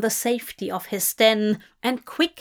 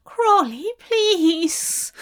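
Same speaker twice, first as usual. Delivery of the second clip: high pleading call; 8 semitones higher, 3 dB louder, much slower than usual. A narrator's reading. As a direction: call out desperately, higher. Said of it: "he called out again".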